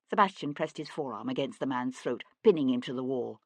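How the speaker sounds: ordinary delivery, nothing marked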